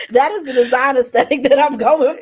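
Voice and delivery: high, loud, through real laughter; laughing delight. Real, not acted. Telling the host about the chosen paint, laughing loudly. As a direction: laugh hard as you declare it.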